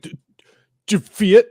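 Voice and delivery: imitating a furious man tripping on words, high and loud; stammer, then burst out with a mock-angry question; angry stutter. Enraged man confronts narrator in line, mock angry stuttering. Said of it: "he's already stuttering, like, he's already so mad".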